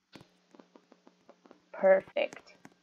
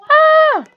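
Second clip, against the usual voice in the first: loud high scream; 15 dB louder than usual. Acted. Son caught, father threatens.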